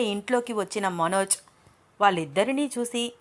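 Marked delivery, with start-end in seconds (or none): none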